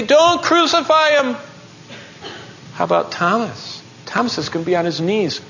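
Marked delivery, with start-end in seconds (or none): 0.0-1.4 high, impassioned cry; urgent protest. Acted. Healed man pleading against the crucifixion, urgent high cry. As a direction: cry out to stop it.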